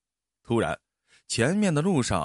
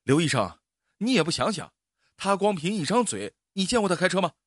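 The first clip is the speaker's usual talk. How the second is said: higher, heated objection; angry protest; 6 semitones higher than usual. Acted. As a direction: argue back at the doctor. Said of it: "司机火了". (司机冲刘医生提高嗓门发火)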